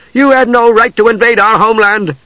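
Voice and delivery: strong, high-pitched accusation; voice back strong. A narrator's reading. Usha accusing the invader of her homeland, strong and high. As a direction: throw it out high and forceful. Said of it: "then came back strong".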